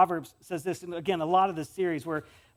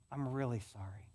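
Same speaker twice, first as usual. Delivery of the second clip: soft sincere sorry; 6 semitones lower, 9 dB quieter than usual. Shown as a demonstration. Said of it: "greatest gifts ... empathy".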